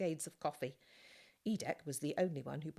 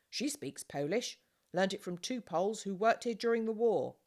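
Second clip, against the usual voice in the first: loud, higher, jovial farmer's voice; hearty announcement; 6 semitones higher, 10 dB louder than usual. A narrator's reading. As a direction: boom out the introductions cheerfully. Farmer announcing guests cheerfully.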